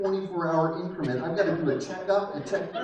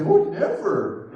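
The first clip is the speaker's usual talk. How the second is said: doting grandparent; 6 dB louder, much slower than usual. Retold or imitated. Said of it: "Grandparents always say".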